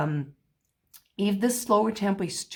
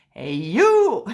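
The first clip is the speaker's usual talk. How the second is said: "Hey, you!" shouted louder; mock yell; 2 semitones higher, 9 dB louder than usual. Shown as a demonstration. Teacher shows yelling at someone, loud mock shout. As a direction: call out sharply across the room. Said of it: "like, yelling at someone".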